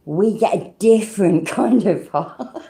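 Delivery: slow, drawn-out jokey insistence with a laugh; mock-earnest Brit defending the complaint. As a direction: insist slowly, laughing at your own claim. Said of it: "And we're like".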